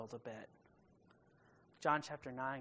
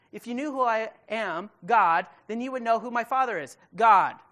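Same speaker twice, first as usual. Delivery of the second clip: forceful preaching; 6 semitones higher, 13 dB louder than usual. Acted. Preacher voicing Jesus' challenge.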